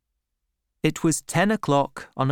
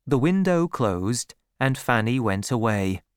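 ordinary delivery

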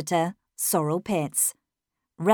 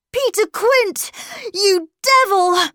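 high, furious cry, slowing on the last words; anguished outburst; 11 semitones higher, 4 dB louder than usual. A narrator's reading. Miles crying out the dreadful name at last, anguished. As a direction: hurl the name out as an accusation.

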